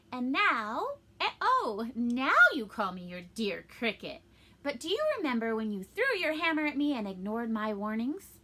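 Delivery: loud, reproachful acted rebuke; scolding. A narrator's reading. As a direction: throw the words back at him, loudly. Cricket scolds Pinocchio over past wrongs.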